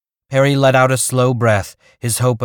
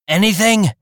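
calling up; 10 semitones higher than usual. Acted. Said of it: "Reef called up".

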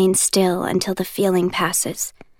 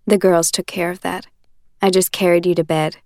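put-on lightly lower, steady young man explaining; Warner's voice; 3 semitones lower than usual. Warner explaining to Juliet, calm and low. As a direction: keep it even and patient.

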